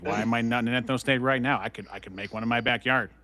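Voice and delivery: usual speaking voice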